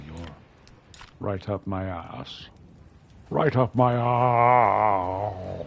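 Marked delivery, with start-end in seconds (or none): 3.3-5.7 very slow, louder, drawn-out Herselbo dialect. Shown as a demonstration. Telling his thralls off in made-up Herselbo dialect, drawn out and loud. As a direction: stretch every word out in the Herselbo dialect. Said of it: "That's how you say it in Herselbo".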